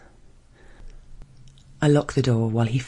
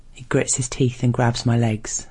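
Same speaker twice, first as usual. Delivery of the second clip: plain reading voice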